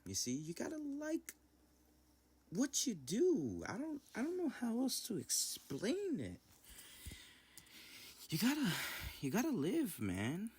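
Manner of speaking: very high, loud, stretched-out squeal; giddy high voice. Real, not acted. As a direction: push it way up high, giddily. Giddy explaining after laughing fits.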